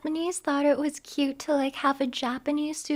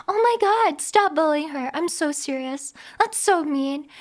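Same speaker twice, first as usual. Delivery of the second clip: usual speaking voice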